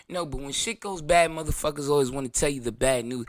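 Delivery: normal talking voice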